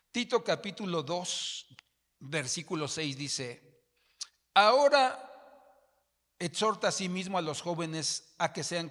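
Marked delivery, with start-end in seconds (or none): 4.5-8.9 loud, high emphatic reading of the verse; full-force sermon reading; preaching scripture. Acted. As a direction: proclaim it to the church.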